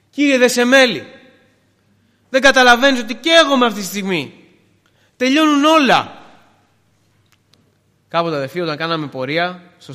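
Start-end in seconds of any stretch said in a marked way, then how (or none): 0.1-6.2 high, loud protest to heaven; loud, anguished appeal, hammed up for a sermon; desperate cry. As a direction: cry out as if all is lost. Acted desperate protest to silent God.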